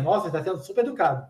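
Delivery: soft muttered inner thought, a bit fast. Retold or imitated. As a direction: think it to yourself, quietly and quickly. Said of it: "Você pensa". (pensamento em voz baixa e rápida)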